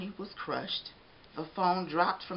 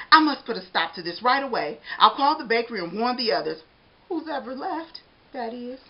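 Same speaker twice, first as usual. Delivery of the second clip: donut character voice; 5 semitones higher, 6 dB louder than usual. Acted. Arnie's thought.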